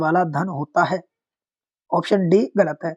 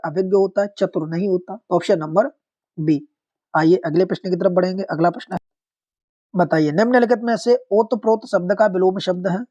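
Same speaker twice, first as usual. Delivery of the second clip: normal talking voice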